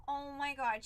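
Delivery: ordinary talk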